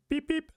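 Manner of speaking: high squeaky scope beeps; squeaky mock beeps; playful beep. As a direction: chirp it like a toy targeting sound. Luffy mimics a scope.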